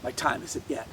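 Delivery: low, soft confiding voice; confidential. A narrator's reading. Jesus telling Jairus his time isn't yet, confidential. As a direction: say it privately, very quietly.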